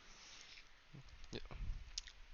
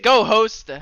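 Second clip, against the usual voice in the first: loud shout; 11 semitones higher, 16 dB louder than usual. Real, not acted. Urging friend to host.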